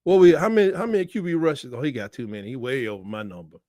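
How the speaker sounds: ordinary talk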